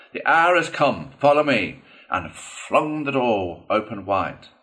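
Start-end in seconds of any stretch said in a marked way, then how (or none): 0.1-1.8 raised, higher battle call; loud heroic summons; rallying cry. A narrator's reading. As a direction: lead your comrades into the charge. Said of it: "glanced round his comrades and cried".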